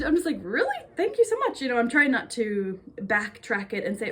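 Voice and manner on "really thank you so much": high-pitched, touched thanks; delighted surprise. Retold or imitated. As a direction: re-enact being happily taken aback by a compliment. Acting out her pleased reply.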